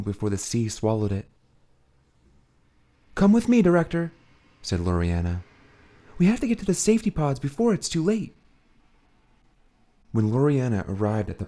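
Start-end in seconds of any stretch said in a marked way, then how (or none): 3.1-8.4 put-on high, loud urgent young woman; urgent plea. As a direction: hurry the director along. Loriana urges director.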